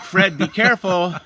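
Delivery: loud, high warning call. Retold or imitated. Captain calling up to Fred in the tree, loud warning. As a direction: shout it up into the tree.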